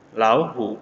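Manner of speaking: Lauhu joined, first syllable lifting, hu low; second tone on lau. Shown as a demonstration.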